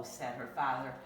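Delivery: usual speaking voice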